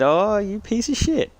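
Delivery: high, slow, singsong mock insult; playful teasing. Telling a teammate how he really teased the American. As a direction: tease it, lilting up high and drawn out. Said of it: "I, I said".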